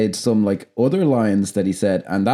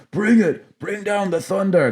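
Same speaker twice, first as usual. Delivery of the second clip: higher-pitched hammed-up battle cry; mock macho soldiers; 9 semitones higher, slower than usual. Podcaster mocking soldiers in Michael Bay films, high macho parody. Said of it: "that's just macho horseshit".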